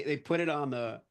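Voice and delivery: normal talking voice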